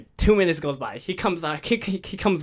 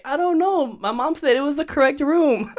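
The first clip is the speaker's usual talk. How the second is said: put-on high-pitched kid excuse; defensive little brother; 9 semitones higher than usual. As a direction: plead innocence in a higher kid voice. Retold brother defending himself.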